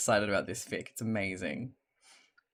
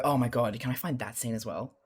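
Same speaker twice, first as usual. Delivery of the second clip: ordinary talk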